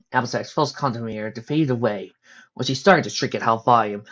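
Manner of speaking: ordinary delivery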